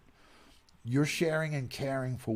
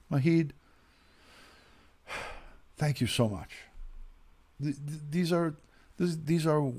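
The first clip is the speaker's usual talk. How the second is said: choked up, voice cracking high and stammering; fighting back real tears as he thanks him; voice breaking; 4 semitones higher than usual. Real, not acted. Thanking a friend for condolences on his mother, voice breaking. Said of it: "I'm gonna start crying again".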